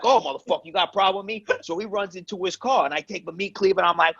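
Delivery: high-pitched mock confrontation; worked-up retelling. Brother recounting his road-rage clash, worked up. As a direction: act out a wound-up brother's challenge.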